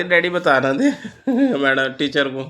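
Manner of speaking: high, amused teasing with laughter; laughing. Real, not acted. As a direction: tease through your laughter. शर्माते बेटे को हँसकर चिढ़ाना. (हँसते हुए चिढ़ाना)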